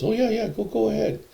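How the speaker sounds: high, loud, easygoing send-off; God. Retold or imitated. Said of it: "he, he goes".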